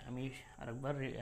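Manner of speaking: usual speaking voice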